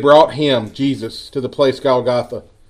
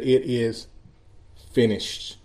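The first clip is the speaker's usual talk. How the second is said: soft, lowered, solemn reading; hushed last words; 2 semitones lower, 8 dB quieter, much slower than usual. A narrator's reading. Jesus giving up his spirit on the cross, hushed. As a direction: let it drop away softly, with a pause. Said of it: "he said".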